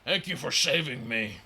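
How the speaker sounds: a dwarf thanking his rescuers; grateful, gruff-ish dwarf; Duergar voice. Acted. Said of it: "this Duergar will say in Dwarvish".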